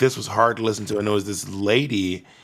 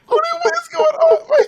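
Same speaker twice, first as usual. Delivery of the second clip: very high, squealing wail of affection; cooing over a crier; 4 dB louder than usual. Real, not acted. Friend comforting the crying host, squealing tenderly. Friend reacts to host's sudden tears.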